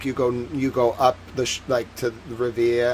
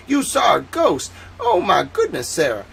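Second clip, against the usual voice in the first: mock astonishment; 6 semitones higher, 5 dB louder than usual. Reacting to Sarah's ghost sighting, mock astonished. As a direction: react aghast, louder and pitched up.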